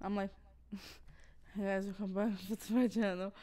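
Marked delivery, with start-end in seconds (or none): 1.5-3.3 quick, soft intro broken by laughter; imitating own slurring. Vlogger mimicking herself thrown by headphone delay, laughing slurred greeting. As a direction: giggle through a mock slurred vlog greeting. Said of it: "I'm, like, slurring my words".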